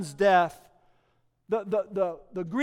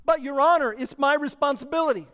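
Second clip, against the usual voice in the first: pleading; 4 semitones higher, 5 dB louder, faster than usual. Acted. Mother pleading with judge.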